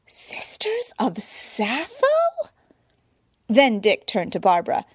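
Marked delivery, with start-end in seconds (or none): 0.1-2.2 silent whisper; mouthed. A narrator's reading. Dick and Dinah mouthing to each other, whispered. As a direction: breathe it without voice. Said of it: "mouthed in unison".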